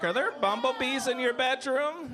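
put-on high-pitched kid voice; nine-year-old child. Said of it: "you were like".

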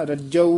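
ਰੱਜੂ loud and tight, not slack. Shown as a demonstration. Teacher says ਰੱਜੂ alone, firm and loud. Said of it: "ਰੱਜੂ ਢਿੱਲਾ ਜਿਹਾ ਨਹੀਂ ਬੋਲਣਾ".

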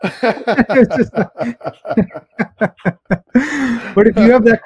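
high, drawn-out laugh through words. Real, not acted. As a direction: crack up at the punchline. Laughs at own meme joke.

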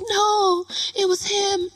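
high-pitched mock-innocent denial; girl shifting blame. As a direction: imitate her pinning it on someone else.

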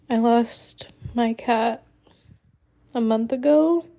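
real tearful voice, pitch creeping up; choked up, long pauses to hold it together; voice cracking. Real, not acted. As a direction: fight back tears through halting, broken phrases. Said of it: "sorry my voice sounds like this".

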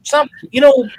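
loud, high-pitched joking complaint, slowing at the end; mock grievance. Co-host joking about never winning hats, mock aggrieved. Joking envy over another's hat win.

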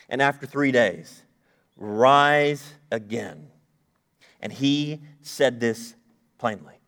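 slow, solemn Bible reading with long pauses; preacher reading Mark aloud to the congregation, solemn and slow; scripture reading. Acted. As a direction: read the verses with weight, phrase by phrase. Reads the scripture passage.

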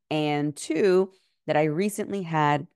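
ordinary talk, nothing marked

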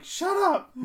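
high, loud parrot squawk. Retold or imitated. Imitating a parrot yelling at a meowing cat, high squawk. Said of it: "and a parrot was like".

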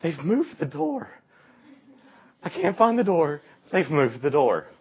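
put-on a girl waking in panic in the dark; panicked girl. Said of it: "this is what her sister hears".

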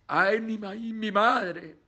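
mock fright. Scared hitchhiker sees a driverless car, mock fright. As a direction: play a scared man gasping for his mother. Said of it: "el pobre miedoso dice".

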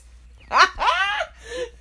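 loud, very high-pitched burst of laughter; high laughing fit. Real, not acted. Co-host losing it after an awkward remark, high laughing fit. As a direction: crack up helplessly.

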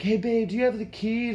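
trying to make it seem normal; mock casual. Planning what to ask his girlfriend, mock casual. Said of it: "just casually be like".

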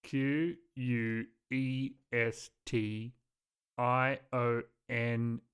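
slow letter by letter; spelling it out. Real, not acted. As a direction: spell each letter slowly. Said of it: "spelling bee letters".

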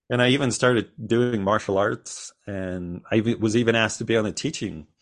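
ordinary talk, nothing marked

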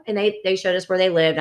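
ordinary delivery

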